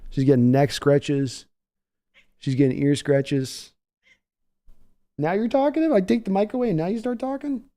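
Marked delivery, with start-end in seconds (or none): 5.2-7.6 high, soft mock-indignant pet voice; teasing the dog. Real, not acted. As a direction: playfully scold the dog in a high voice. Dog makes noise after mic moved.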